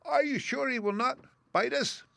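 very high, timid squeaky question. A narrator's reading. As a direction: ask it like a nervous mouse. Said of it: "asked in a timid voice".

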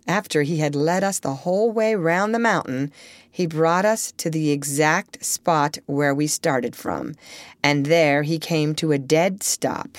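ordinary delivery, nothing marked

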